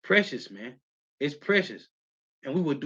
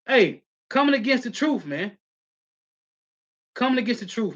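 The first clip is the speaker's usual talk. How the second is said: indignant preaching; 7 semitones higher than usual. Real, not acted. Preacher denounces online critics.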